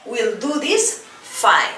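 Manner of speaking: teacher chanting a rhyme slowly; rhythmic rhyme recital. Shown as a demonstration. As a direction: recite the rhyme to a beat for the class. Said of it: "Let's do it all together".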